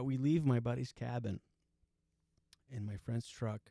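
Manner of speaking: normal talking voice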